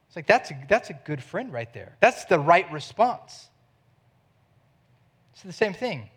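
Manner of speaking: ordinary delivery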